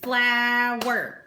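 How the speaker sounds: "flower" split in two syllables, FLOW-er; syllables clapped out. Shown as a demonstration. Teacher showing kids how to count syllables, clapping. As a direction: clap out "flower" in two beats. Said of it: "count the syllables in flower".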